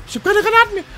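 very high, loud shriek; panicked shrieking. Real, not acted. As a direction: scream it in desperate game panic. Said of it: "Hat man an deinen männlichen Schreien gehört". (hohes, panisches Kreischen)

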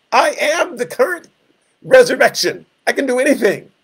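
a light, teasing take on Jesus bragging; boastful Jesus. Retold or imitated. Jesus correcting Martha, playfully voiced.

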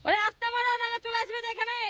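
high, carrying call across the field. Real, not acted. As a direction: call out to him from a distance. Calling out to grandpa.

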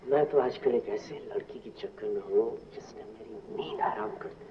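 drops to a near whisper to confide; softly confessing his love; hushed confession. Acted. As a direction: lean in and tell it low, like a secret. Confiding about a girl.

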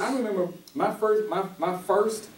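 usual speaking voice

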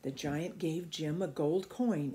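plain reading voice

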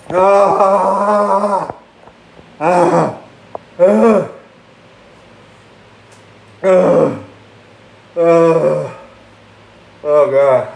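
loud, high groans of burning pain. Real, not acted. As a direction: cry out from the spicy burn. Spitting out chip, in pain.

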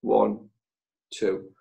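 slow, falling count of two; counting the pause. Shown as a demonstration. Coach showing the two-second pause before replying, slow count. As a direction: count it out, leaving space. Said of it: "count two seconds".

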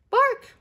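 dog bark. Acted. Little sister Lola barking like a dog for her brother, loud.

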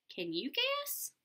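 high teasing ask; high sing-song can you guess; playful question. Acted. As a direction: toss the question up high to the kids. Asks kids teasing question.

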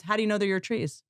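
ordinary delivery

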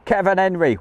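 high, lively name call; excited shout-out. Real, not acted. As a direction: greet a viewer with a lift. Greets viewer by name.

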